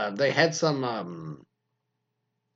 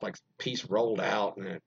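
normal talking voice

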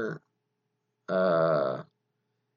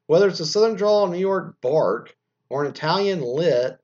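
loud, raised reading voice; article read-aloud; 8 semitones higher, 7 dB louder than usual. Acted. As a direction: project the copy to listeners.

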